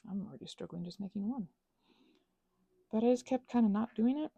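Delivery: ordinary talk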